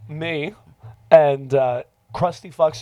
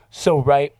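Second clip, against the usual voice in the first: usual speaking voice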